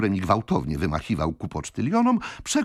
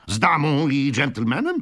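high, impatient question; eager old gentleman, pitched up; trembling with impatience; 7 semitones higher, 4 dB louder than usual. A narrator's reading. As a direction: ask it as if you can barely wait. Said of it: "drżący od niecierpliwości".

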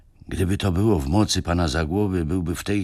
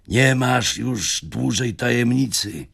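exclaimed; 6 semitones higher, slower than usual. Acted. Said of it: "zawołał Michał".

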